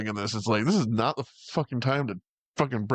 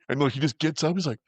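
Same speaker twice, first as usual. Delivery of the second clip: ordinary talk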